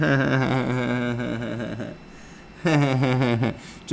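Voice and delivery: long, droning fake chuckle; mock posh guffaw; smug fake laugh. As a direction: hold out a mechanical ha-ha-ha. Parody of smug middle-class comic.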